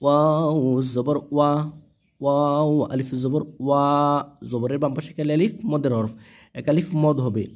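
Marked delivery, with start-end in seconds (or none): none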